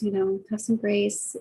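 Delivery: slow, lingering have some grace. Real, not acted. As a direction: let it land slowly, as advice. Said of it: "just have some grace".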